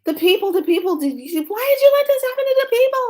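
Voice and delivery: high, loud panicked scream; high frantic shout at the spirits; retold screaming. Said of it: "I was screaming".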